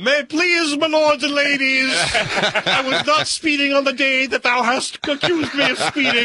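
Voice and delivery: high, laughing archaic courtroom plea; mock old-English plea. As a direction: ham up a grand plea to the judge.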